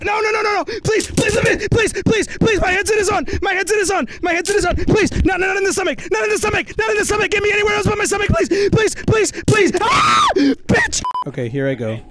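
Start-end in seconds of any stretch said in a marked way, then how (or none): 0.0-9.7 high, rapid-fire begging in real panic; frantic pleading. Real, not acted. Begging not to be tased. 9.8-11.0 very high yelp of shock, then a shouted curse; pained scream. Real, not acted. Gets tased.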